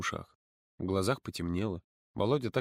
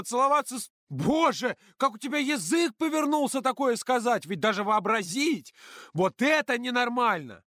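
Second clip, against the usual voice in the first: higher, slow furious counselor; angry shout; 14 semitones higher, 4 dB louder than usual. A narrator's reading. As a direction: yell it in outrage. Ira furious tirade. (Ира кричит гневно)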